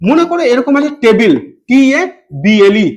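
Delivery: usual speaking voice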